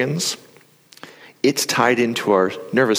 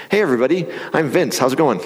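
higher, squeezed stage greeting; tense, tight voice; 6 semitones higher than usual. Shown as a demonstration. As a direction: show a throat full of tension. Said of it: "my voice sounds like this".

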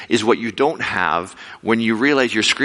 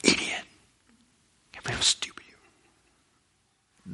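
whispered, much softer and slow; scornful whisper; 9 dB quieter, slower than usual. Retold or imitated. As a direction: hiss self-contempt under your breath. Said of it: "you're like".

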